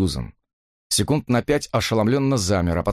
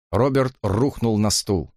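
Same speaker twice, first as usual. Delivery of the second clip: plain reading voice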